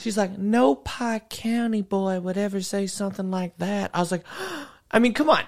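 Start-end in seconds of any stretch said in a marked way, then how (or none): 0.4-3.9 put-on slow, lightly drawn Southern Ohio accent. Retelling the Pike County girl, in a light Southern Ohio accent. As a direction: give her country Ohio accent, slowly. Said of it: "had that fucking accent".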